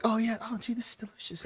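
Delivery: mildly gushing, high and soft; light high-pitched mimicry of happy tasters; delighted customers. Passersby sampling the hookah, delighted.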